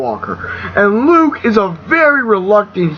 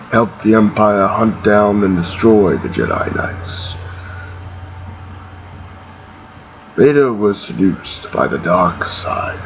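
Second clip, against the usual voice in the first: put-on low, hesitant old mentor; deep and halting, as if the memory is hard; Alec Guinness; 8 semitones lower than usual. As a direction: reluctantly reveal a painful past in a lowered voice. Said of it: "he's almost hesitant".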